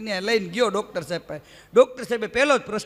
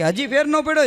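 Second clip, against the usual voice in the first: impatient doctor; 6 semitones higher than usual. Retold or imitated. Doctor pushing his patient with a mock impatient question. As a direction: press the patient, half amused.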